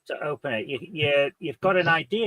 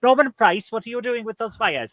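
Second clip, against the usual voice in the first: put-on Welsh accent; 6 semitones higher than usual. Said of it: "walking tour of Britain again, aren't I, with the accent".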